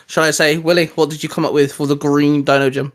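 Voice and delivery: usual speaking voice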